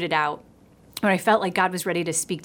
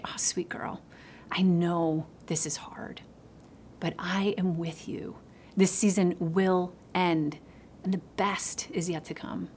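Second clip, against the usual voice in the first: tender whisper; 7 dB quieter than usual. Retold or imitated. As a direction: whisper it like soothing a child. God's comforting answer, retold.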